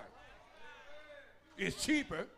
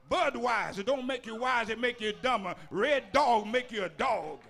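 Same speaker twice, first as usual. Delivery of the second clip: preaching at full force; 2 semitones higher, 5 dB louder than usual. Real, not acted. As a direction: hammer each pressure out like a hellfire preacher.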